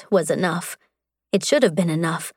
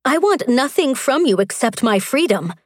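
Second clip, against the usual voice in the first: higher, heated declaration; declared hotly; 6 semitones higher than usual. Acted. Mara rejects Strax at dagger point, heated and higher. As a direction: throw the words at him hotly. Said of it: "she declared hotly".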